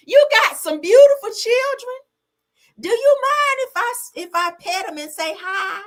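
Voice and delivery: Miss Millie. Retold or imitated. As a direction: gush over the kids in a high, fake-sweet voice. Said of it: "like, uh, Miss Millie off Color Purple".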